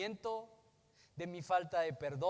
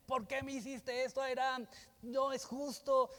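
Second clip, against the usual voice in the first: whiny complaint; 6 semitones higher than usual. Pretend. Acted hurt complaint at Airán.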